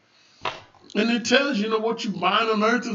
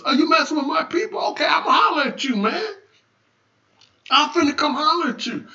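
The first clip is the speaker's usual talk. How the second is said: higher, fast-talking protective challenge; confrontational warning; 5 semitones higher, faster than usual. Acted. God warning those messing with his people, confrontational. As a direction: step up to them as the protector.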